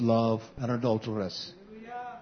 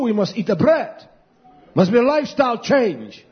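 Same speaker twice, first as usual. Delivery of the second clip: raised, driving preacher's voice; impassioned preaching; 6 semitones higher, 4 dB louder than usual. Real, not acted. Preacher urging a lifestyle change on the congregation, impassioned preaching. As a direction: build to loud, high peaks as you preach. Preaches health message at force.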